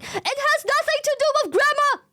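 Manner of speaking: high-pitched, indignant rant; exasperated outburst. Real, not acted. Streamer rebutting the buffalo grammar claim, exasperated. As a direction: argue it hotly, voice jumping way up.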